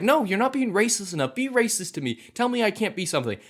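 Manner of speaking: high, whiny mock kid voice demanding; hammed-up high girl voice insisting; mock little girl. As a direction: squeak it out like a bratty kid mocking her. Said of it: "And Riri's like".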